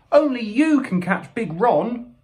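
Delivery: high, squeaky, slow plea; small creature crying out; urgent cry. A narrator's reading. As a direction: implore in a high little voice. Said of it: "cried Percival".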